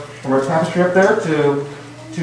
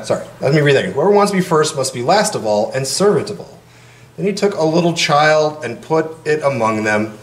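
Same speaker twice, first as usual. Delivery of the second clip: steady formal gospel reading; scripture reading. Acted. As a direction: proclaim it to the congregation. Reads the gospel aloud.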